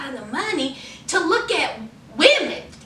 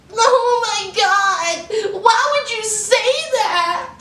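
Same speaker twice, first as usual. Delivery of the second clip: mock tearful Lacey; 7 semitones higher than usual. Daughter wailing over her father's words, mock tearful. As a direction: wail it in mock hurt, stretching the words. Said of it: "who talk like that ... didn't care about her tears".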